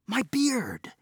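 high astonished exclamation; sudden high gasp of wonder; amazed cry. Acted. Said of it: "he cried".

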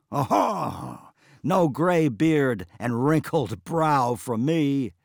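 loud, slow bragging; loud swaggering brag; chest-thumping boast. Acted. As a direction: crow about yourself proudly. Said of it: "flexing his arms and thumping his chest".

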